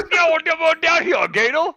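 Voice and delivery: high, loud hammed-up Cajun call; Cajun accent. Host calling a gator over in a hammed-up Cajun accent. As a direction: holler to the gator like a bayou man. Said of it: "my Southern jambalaya eater".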